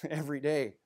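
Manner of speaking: laughing through the words. Real, not acted. Preacher admitting his daily need, laughing. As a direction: chuckle it out. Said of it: "laugh heard".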